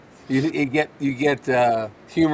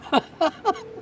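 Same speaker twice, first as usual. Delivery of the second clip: laugh. Real, not acted. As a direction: laugh high and light. Amused at own remark about calling.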